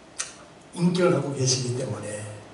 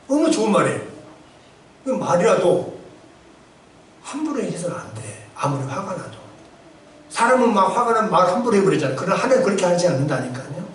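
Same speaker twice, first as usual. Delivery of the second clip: ordinary delivery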